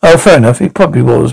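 ordinary talk

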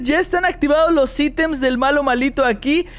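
ordinary talk, nothing marked

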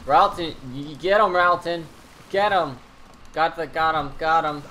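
loud, excited urging of a pet to attack; urging shout. Real, not acted. Gamer urging his pet Ralton to attack, loud and eager.

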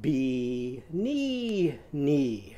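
"Be-, knee, knee." with every syllable made an ee; Bee-knee-knee. Shown as a demonstration. Teacher changing banana's vowels to ee for kids. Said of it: "change it to all of those syllables to Eve sound".

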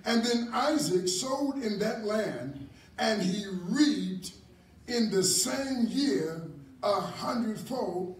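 slow, weighty reading of the blessing; slow emphatic reading. Acted. Preacher reading the harvest verse to the congregation, slow and weighty. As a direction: stretch each phrase to let it sink in.

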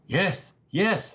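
surprised cry. A narrator's reading. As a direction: call it out as if the sight just appeared. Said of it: "he cried in a tone of considerable surprise".

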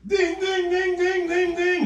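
high loud ding ding bell; bell imitation. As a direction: ring the bell like a match ending. Referee bell after count.

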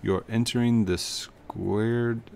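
very slow, drawn-out guru voice; unhurried, softening meditative delivery; serene retreat host. Acted. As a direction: welcome them like a laid-back zen guide. Reads a retreat host's game dialogue.

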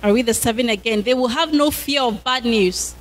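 ordinary talk

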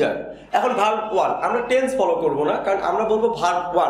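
usual speaking voice

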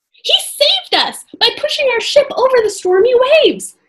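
high, loud eager telling; excited. A narrator's reading. Annie telling Morgan how the serpent saved them. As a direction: tell it with wonder. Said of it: "Annie said".

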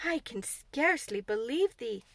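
slow, disbelieving Yorkshire protest. Acted. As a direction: push back in disbelief. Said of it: "she protested".

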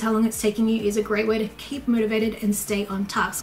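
normal talking voice

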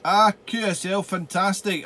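usual speaking voice

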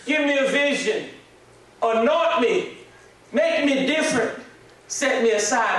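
praying at the altar for a calling, loud; loud pleading prayer. Retold or imitated. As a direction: beg the Lord loudly to be used. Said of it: "I began to say".

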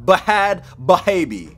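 "Bahad Bahabi" with an extra h syllable in each word; mock literal reading. Shown as a demonstration. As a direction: read "Bahad Bahabi" exactly as misspelt, buh-HAD buh-HAY-bee. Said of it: "It says Bahad Bahabi".

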